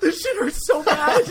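very high, slow strained cry with a laugh; high, slow, hammed-up pained whine; pained wail. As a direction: wail it out in agony, half laughing. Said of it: "I literally started praying".

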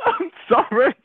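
high, laughing apology; giggling uncontrollably, can't stop; shocked laughter. Real, not acted. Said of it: "I don't know why I'm laughing".

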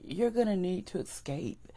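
usual speaking voice

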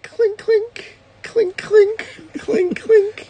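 very high, ringing clinks; imitated clinking. Describing what you're hearing, high imitated clinking. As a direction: make high metallic "clink" sounds. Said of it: "So you're hearing".

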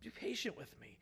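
soft, hushed plea; almost under the breath, a quiet plea; pleading. A narrator's reading. Servant pleading with the king for mercy, hushed plea. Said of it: "He pleads for mercy".

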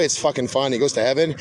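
ordinary talk, nothing marked

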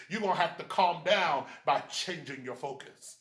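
ordinary talk

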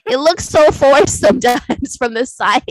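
loud, giggly complaint; real laughter breaking into speech; laughing while talking. Real, not acted. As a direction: crack up through the words. Cracking up while mocking the lip.

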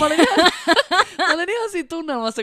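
high laughing, words bursting out fast; giggling through her own embarrassing story. Real, not acted. Telling a cohost about her blunder, laughing high.

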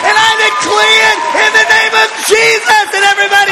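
very high, loud declaration shout; top-of-range revival shout, sustained; full-force preaching. Real, not acted. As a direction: roar promises over the crowd. Preaching climax, later names that intensity.

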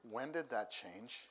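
normal talking voice

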